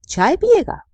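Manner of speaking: high, loud cheerful question; bright offer. A narrator's reading. Mother offers her son tea, high and loud. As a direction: call it out brightly.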